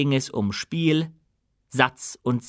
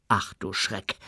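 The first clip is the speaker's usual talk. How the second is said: softer, lower, dismayed inner voice; quiet thought; 4 semitones lower, 5 dB quieter than usual. Acted. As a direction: say it under your breath. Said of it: "dachte Feucht".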